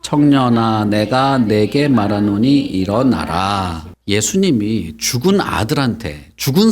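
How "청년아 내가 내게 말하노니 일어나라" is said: slow, measured group recitation; read-aloud verse. Shown as a demonstration. Pastor leads children reading the verse, slow recitation. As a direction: lead the reading, drawing out the words. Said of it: "거기서부터 읽어봅시다".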